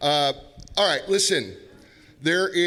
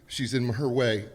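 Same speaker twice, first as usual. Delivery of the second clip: ordinary talk, nothing marked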